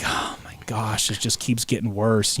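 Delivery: sinking, whispery exasperation; dismayed undertone. Retold or imitated. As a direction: mutter it under your breath, near a whisper. Retold dismay as things worsen.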